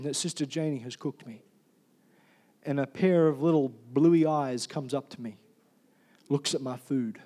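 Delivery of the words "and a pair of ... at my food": ordinary delivery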